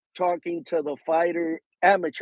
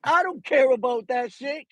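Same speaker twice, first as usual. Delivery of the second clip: cocky mock boast; 8 semitones higher than usual. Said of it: "Keyshawn is saying".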